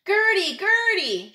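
calling the seagull's name up high and loud. Acted. Chomp's mother calling up to Gertie: high, loud call. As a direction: shout her name across the water, high. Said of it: "she called out".

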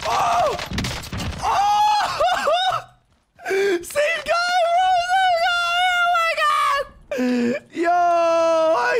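high, shrieking disbelief; ecstatic yelling. Real, not acted. Gamer got the same guy, ecstatic yelling. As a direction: shriek with glee, words stretched out.

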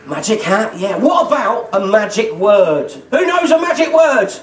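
put-on upbeat children's entertainer patter; kids party magician. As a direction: quiz the kids brightly.

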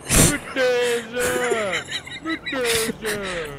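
slow, dragged, high-pitched demands; very slow high stoned challenge; paranoid stoner. Acted. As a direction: stretch each word out, spaced out.

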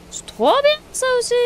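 high, loud thrilled exclamation; delighted. Real, not acted. Player thrilled by the machine he just built: excited. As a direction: gush over the new machine.

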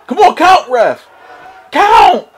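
high shout at the ref; yelling from the sidelines. Real, not acted. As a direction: yell at the referee to count.

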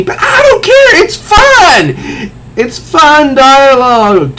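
real glee at campy dialogue; gleeful. Real, not acted. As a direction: gush about how fun it is.

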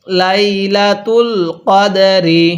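slow drawn-out Quran recitation of লাইলাতুল কদরি; slow tajweed chant. Shown as a demonstration. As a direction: recite লাইলাতুল কদরি slowly, each syllable held. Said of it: "আরেকবার পড়ুন".

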